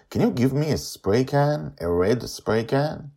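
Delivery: put-on high, eager child voice; a girl catching her friend before he goes; little girl Joy. A narrator's reading. Joy stops Charlie before he leaves, high childlike voice.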